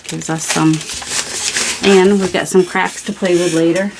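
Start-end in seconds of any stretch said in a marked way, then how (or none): none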